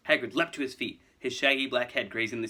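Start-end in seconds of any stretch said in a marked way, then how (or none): none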